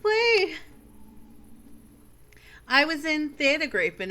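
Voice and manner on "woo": excited cheer. Real, not acted. Cheering new member joins.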